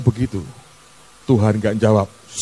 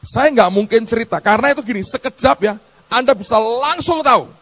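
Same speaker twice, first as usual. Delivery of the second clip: impassioned sermon voice, raised high; fiery preaching; 12 semitones higher than usual. Acted. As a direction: preach it hard to the congregation.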